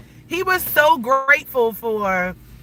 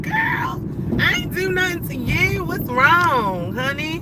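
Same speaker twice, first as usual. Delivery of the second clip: higher, sugary "what's wrong, honey"; mock sweet confrontation; 8 semitones higher than usual. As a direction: sweetly challenge a woman glaring at you.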